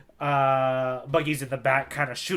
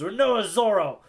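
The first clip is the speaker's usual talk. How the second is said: Buggy; 5 semitones higher than usual. Retold or imitated. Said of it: "and Buggy goes".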